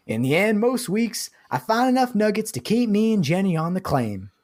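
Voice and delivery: put-on cranky old gold miner rambling; crotchety old prospector. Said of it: "Reading the character of a crotchety old prospector".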